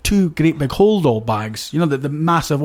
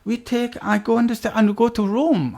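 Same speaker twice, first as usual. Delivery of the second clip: higher, put-on Swedish accent, broken English; bad Swedish accent; 6 semitones higher than usual. Retelling prison cellmate's words in mock Swedish accent. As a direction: mimic a Swedish cellmate badly. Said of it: "it's not good Swedish accent".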